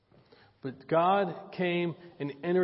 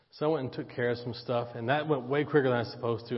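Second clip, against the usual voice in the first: ordinary talk, nothing marked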